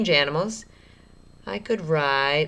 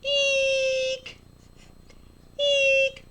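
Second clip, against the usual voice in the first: very high squealing "Eek"; shrill mock fright squeal; excited squeal. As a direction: squeal like you just saw a snake. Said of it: "I kept yelling".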